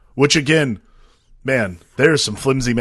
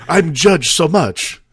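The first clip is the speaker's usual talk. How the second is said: put-on slow, higher hammy Dracula accent; 5 semitones higher, 4 dB louder, much slower than usual. Mummy complaining of being judged, Dracula accent. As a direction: draw it out in a mock Dracula accent. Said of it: "Now I'm going towards Dracula".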